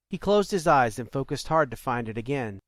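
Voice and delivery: ordinary delivery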